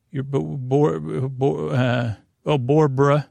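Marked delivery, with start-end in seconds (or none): none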